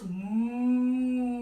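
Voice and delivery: mooing like a cow. Pretend. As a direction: give a long playful moo. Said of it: "you might wanna do a moo".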